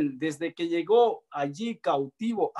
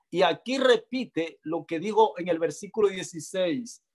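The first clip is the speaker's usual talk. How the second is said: ordinary talk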